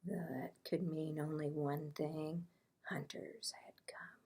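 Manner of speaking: fast hushed whisper; quick frightened whisper. A narrator's reading. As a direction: breathe it in fear. Repeated suspense line.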